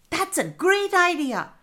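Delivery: high, bright burst of approval; excited, high-pitched approval; delighted child. A narrator's reading. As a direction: agree eagerly in a high kid's voice. Cammy delighted by the suggestion.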